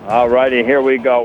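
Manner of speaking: loud, upbeat welcome back; energetic restart. Real, not acted. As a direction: kick off the segment with energy. Commentator returns from break.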